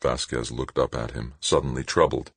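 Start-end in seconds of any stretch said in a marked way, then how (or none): none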